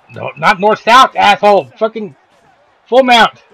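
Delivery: real annoyed shout at the game, slow then loud; frustrated yelling. Real, not acted. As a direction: yell at the screen as the position flips. Yelling at game opponent's move.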